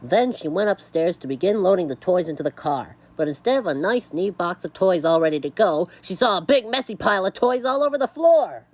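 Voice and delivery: lively picture-book reading; story narration. A narrator's reading. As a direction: read it to children with punched words. Reads a story aloud.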